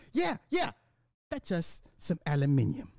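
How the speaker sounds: high, soft British reprise; mock British accent. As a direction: slip back into the pretend Brit, quietly.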